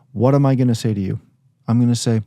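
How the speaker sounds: usual speaking voice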